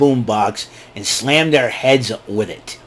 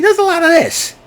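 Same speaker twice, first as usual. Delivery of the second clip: mocking. Mocking a talker.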